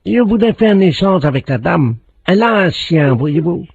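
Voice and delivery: lightly put-on Chinese accent; a hint of a Chinese accent. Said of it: "Un, un Chinois".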